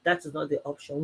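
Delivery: soft, calm husband soothing his wife; tender husband. Retold or imitated. Husband calming his ranting wife, soft and tender. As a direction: gently talk her out of quitting. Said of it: "He said".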